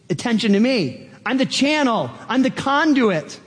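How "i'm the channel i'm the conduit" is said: high, exultant declaration; thrilled. Acted. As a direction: proclaim it with delight. Returns to thrilled channel role.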